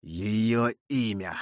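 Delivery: slow, pressing demand; insistent demand. A narrator's reading. Monk leans over the dying man, slowly insisting. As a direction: draw each word out to force an answer. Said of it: "словно желая силой вырвать у него".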